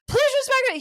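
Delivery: high, pleading mock whine; beg, hammed-up. Angry man demanding respect, mock whine. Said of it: "He's like".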